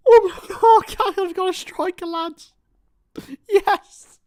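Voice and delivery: high, breathless, slow joy; overwhelmed with joy. Real, not acted. Fan telling the lads about the striker, overwhelmed. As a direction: let it spill out in disbelief.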